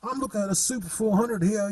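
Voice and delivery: light, quick mock English accent on the phone. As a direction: toss it off like a London bloke calling a mate. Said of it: "in a Cockney accent, actually, an English accent".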